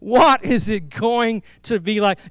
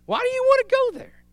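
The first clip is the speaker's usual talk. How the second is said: mock incredulity. Mocking rhetorical question.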